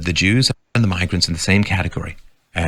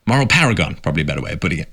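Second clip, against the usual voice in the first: ordinary talk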